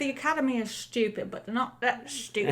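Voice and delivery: put-on chatty Cockney accent; Cockney accent, in-character interview; Cockney lamplighter. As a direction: talk as a musing Cockney lamplighter.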